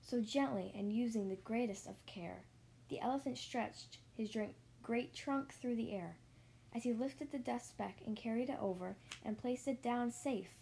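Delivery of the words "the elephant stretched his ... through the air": ordinary delivery, nothing marked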